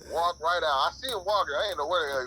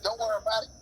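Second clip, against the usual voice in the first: mocking mom; faster than usual. Driver mocking the mom's whining reply, high mock voice. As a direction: squeal it like a mocked, sobbing mom. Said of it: "Just like".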